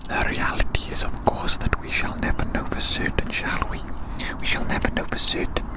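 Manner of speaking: normal talking voice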